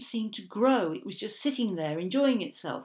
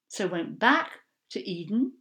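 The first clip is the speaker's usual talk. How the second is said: usual speaking voice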